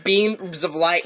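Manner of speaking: ordinary talk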